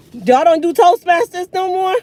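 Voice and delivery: can't believe what she's hearing; exasperated disbelief; incredulous. Real, not acted. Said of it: "incredulous rant at athletes".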